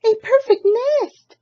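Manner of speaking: high loud hen voice; a squealing hen thrilled by the nest; delighted chicken. Acted. Chicken marvels at the nest, squealing high and loud. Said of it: "she cried".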